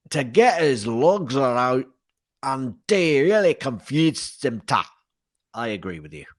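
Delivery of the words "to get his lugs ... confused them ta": rough imitation of a Scotsman's accent; butchered Scottish accent. Retelling a Scotsman's parliament statement to co-host, butchered Scottish accent. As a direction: have a botched go at broad Scots. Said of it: "I probably butchered it completely".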